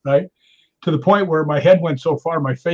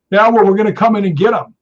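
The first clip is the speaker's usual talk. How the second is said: loud, higher imitation of a defiant intruder; mock belligerent; 6 semitones higher, 8 dB louder than usual. Drunk intruder to the docent, mock belligerent. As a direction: throw the threat back, acted loud.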